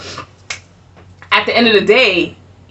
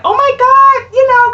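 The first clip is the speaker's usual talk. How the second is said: squealed high oh my God, much louder; gushing clerk; 5 dB louder than usual. Retold or imitated. Clerk gushes at husband's military ID, high excited squeal. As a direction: starstruck clerk swooning over the military ID. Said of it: "she was like".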